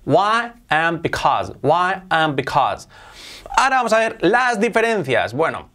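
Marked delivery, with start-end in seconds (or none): 0.0-2.9 "Why and because" said very slowly and clearly; slow clear demo; slow model words. Shown as a demonstration. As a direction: teach each word at half speed. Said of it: "model pronunciation".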